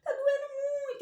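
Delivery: high, whimpering mock cry; little letter A crying to her friend. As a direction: whine about the bump like a hurt child. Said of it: "Aí o A falou assim".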